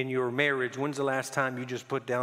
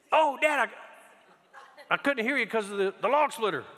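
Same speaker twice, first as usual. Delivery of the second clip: put-on high, light kid voice; son's excuse; 10 semitones higher, 4 dB louder than usual. Boy explaining to his dad why he didn't hear, kid voice. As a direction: play the son's innocent excuse. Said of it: "[name] goes".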